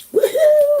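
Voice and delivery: high-pitched "woohoo" cheer; high whoop. Real, not acted. Card reader cheering to herself, high whoop. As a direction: let out a high, playful whoop. Spontaneous cheer during the reading.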